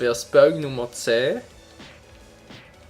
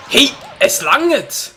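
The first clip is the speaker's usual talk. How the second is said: fed-up shout; 9 semitones higher, 6 dB louder, much slower than usual. Real, not acted. Frustrated at the game.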